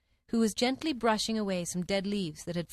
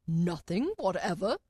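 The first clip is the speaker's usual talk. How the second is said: persistent king. A narrator's reading. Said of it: "persisted the king".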